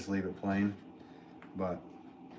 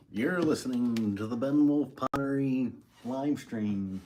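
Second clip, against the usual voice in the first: slow, loud radio announcer, pitched up; commercial voice; 5 semitones higher, 6 dB louder, slower than usual. Pretend. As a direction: boom it out like a station ID, slowly. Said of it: "commercial voice".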